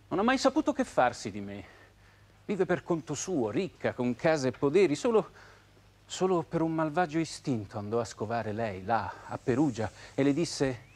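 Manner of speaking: normal talking voice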